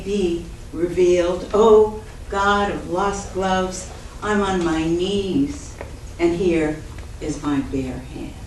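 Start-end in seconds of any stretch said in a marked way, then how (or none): none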